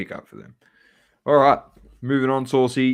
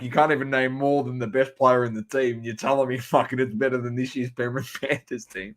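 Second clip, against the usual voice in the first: retold outburst; 5 semitones higher, 6 dB louder than usual. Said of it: "I'm like".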